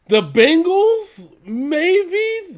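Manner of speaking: high-pitched, drawn-out hedging; squeaky, tentative concession; doubtful maybe. Real, not acted. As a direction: stretch it out high, unconvinced. Doubtfully weighing a possible win.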